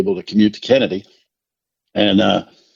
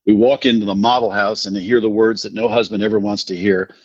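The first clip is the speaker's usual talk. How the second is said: usual speaking voice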